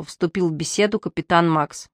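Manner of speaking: usual speaking voice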